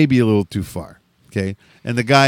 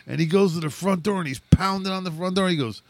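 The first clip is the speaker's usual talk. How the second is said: ordinary talk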